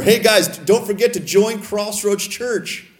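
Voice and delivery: high, slow, drawn-out announcement; eager reminder. Retold or imitated. Pastor reminding the congregation to join, high and drawn out. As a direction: stretch out the reminder, eager and bright.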